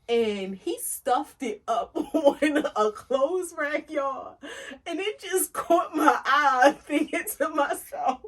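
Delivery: real laughter through the story; laughing. Real, not acted. Funny story about weave.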